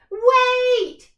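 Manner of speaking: very loud, high cry; a pirate running up to halt the jump. Acted. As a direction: stop everyone at once. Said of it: "she cried".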